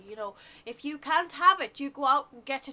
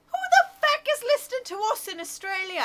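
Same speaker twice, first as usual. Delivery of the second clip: high, loud amazement; shocked disbelief; 6 semitones higher, 4 dB louder than usual. Real, not acted. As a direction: gasp it out in disbelief. Shocked at Australian listener.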